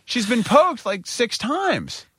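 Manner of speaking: high pleading outrage slowing on the last words; a parent pushing back against another IV attempt; exasperated protest. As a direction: stretch out the final words in disbelief. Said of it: "And I'm like".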